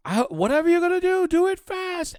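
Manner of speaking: high, strained, urgent guard voice. Acted. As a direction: push the words out while straining. Dying guard strains against gate.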